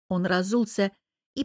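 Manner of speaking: ordinary delivery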